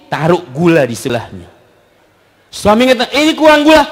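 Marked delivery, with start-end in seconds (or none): 3.1-3.8 high, louder mock grumble; complaining husband. Husband to wife over coffee, mock complaint.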